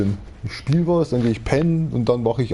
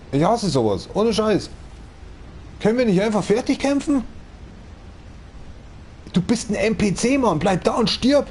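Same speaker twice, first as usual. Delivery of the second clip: high, exasperated rant at the game; annoyed rant; 10 semitones higher than usual. Real, not acted. Yelling at fleeing game enemy. (genervt, hohe Stimme)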